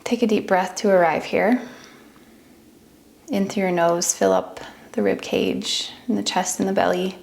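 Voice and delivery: unhurried meditation voice with long pauses; guided meditation. Acted. Teacher talks listeners into relaxing each body part, guided meditation. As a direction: ease them gently through a body scan, leaving space between phrases.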